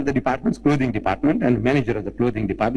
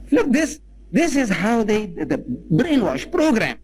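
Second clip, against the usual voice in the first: indignant outburst; 8 semitones higher, slower than usual. Real, not acted.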